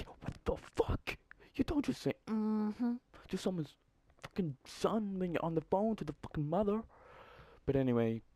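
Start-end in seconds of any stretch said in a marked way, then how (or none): 0.0-1.2 whispered disbelief. Retold or imitated. Retold reaction during phone call. 1.5-6.8 squeaky indignation. Retold or imitated. Retold indignation at headteacher.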